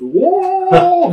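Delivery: high, loud falling whoa; startled cry. Retold or imitated. Giant tackled by the ankle in the hallway, high startled whoa. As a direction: sell the surprise of being knocked off your feet.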